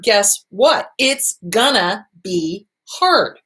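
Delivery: usual speaking voice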